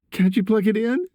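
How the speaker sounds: put-on very high boy's voice; pleading son. A narrator's reading. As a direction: ask Dad in a high, urgent pitch. Upset son pleading on phone.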